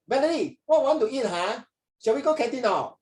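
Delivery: high, casual Singlish schoolmate voice; Singlish friend. Retold or imitated. Friend asking Valerie to go canteen, high-pitched Singlish. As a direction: call out high in breezy Singlish, imitating a friend. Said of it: "your friend say".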